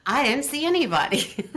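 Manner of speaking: insistent denial. Retold or imitated. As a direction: deny it harder, pushing back.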